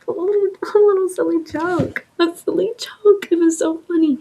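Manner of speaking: high, mock-cheerful sarcasm; sarcastic mock delight. Real, not acted. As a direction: drip sarcasm on it. Sarcastic retelling of his joke.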